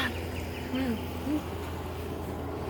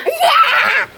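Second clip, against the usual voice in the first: triumphant shout; 10 semitones higher, 5 dB louder than usual. Real, not acted. Winning the race.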